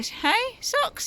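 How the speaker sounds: high cooing call. Real, not acted. Mum coos up to Socks in the tree, high baby talk. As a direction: lift the voice way up to coax the cat.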